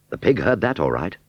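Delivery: plain reading voice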